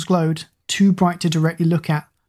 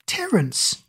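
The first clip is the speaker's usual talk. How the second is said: higher, surprised call of the dog's name; happy yell; 7 semitones higher, 4 dB quieter than usual. Acted. Kira spots her terrier running in, higher surprised call. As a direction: call out to your dog, delighted and puzzled. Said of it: "she yelled in happy confusion".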